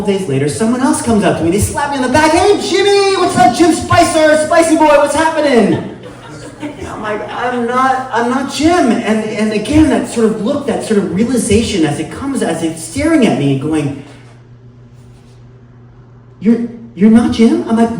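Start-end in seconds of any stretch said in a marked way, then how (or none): 2.3-6.0 loud, high, whooping buddy holler; boisterous greeting. Acted. Someone slaps a supposed friend's back, boisterous greeting. 7.2-8.9 high-pitched, halting denial; weary protest. Acted. Mistaken man corrects a second stranger, protesting. 16.4-17.7 high, stammered question, rising at the end; stunned disbelief. Acted. Stranger realizing he has the wrong man, stunned.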